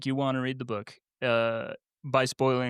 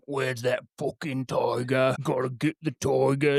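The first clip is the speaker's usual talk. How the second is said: Tom Hardy impression. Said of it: "You know how Tom Hardy talks".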